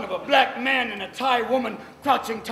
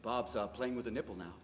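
hushed golf commentary; 7 semitones lower, 7 dB louder than usual. Pretend. Golf commentator narrating the bedroom, mock hushed commentary. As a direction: murmur like a commentator not disturbing the putt. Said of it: "golf commentator".

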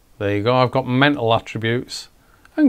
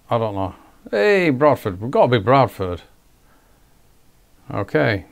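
usual speaking voice

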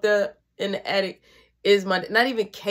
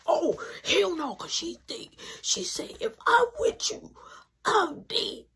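higher, wailing mock cry; mock panic; 8 semitones higher than usual. Refusing to hide brother from mom, mock panicked cry. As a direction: whine it like you'll be killed.

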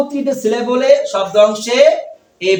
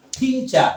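"থি, চা" with "থি" held and stressed; stress on first syllable; 4 semitones higher, 4 dB quieter, much slower than usual. Shown as a demonstration. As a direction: lean on "থি", then a separate "চা". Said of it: "stress দিলাম।".

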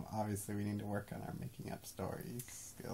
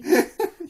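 high loud laugh; 19 dB louder than usual. Real, not acted. Laughing at own story.

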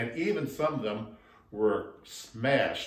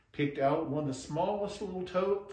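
ordinary delivery, nothing marked

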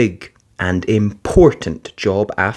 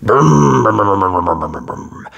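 fading brum brum; imitated engine; 2 semitones lower than usual. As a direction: rev like a digger. Digger engine noise.

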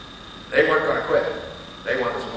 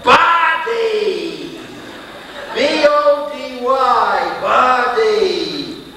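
the word body yelled out high and loud, then spelled slowly; a full-voiced cry of the word body; shouted; 9 semitones higher, 7 dB louder, much slower than usual. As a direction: she finally shouts the answer. Lady finally yells the word.

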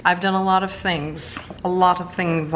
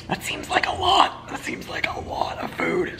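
whispered aside; 13 semitones higher, 5 dB quieter than usual. Retold or imitated. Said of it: "And I'm like".

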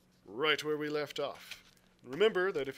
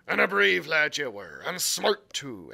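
put-on louder pirate brogue, boastful old sea cook; Long John Silver; 7 dB louder than usual. A narrator's reading. Silver boasting to the lad about Flint's crew, pirate brogue. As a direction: swagger it like a one-legged pirate cook.

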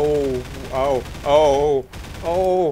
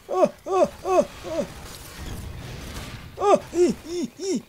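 much higher yelping oh and ee sounds; real startled yelps, far higher; panicked squeals; 3 dB quieter, slower than usual. Real, not acted. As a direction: squeal in alarm as bullets fly. Startled under fire.

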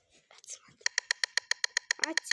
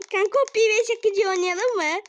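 higher, louder eager invite; higher, louder eager invitation; eager ask; 8 semitones higher, 7 dB louder than usual. Real, not acted. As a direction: pitch it excitedly. Excited invite to friend.

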